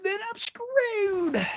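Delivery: high, drawn-out squeal; mock dread. Real, not acted. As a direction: stretch it into a high, slow wail. Imagines a knife in the bed.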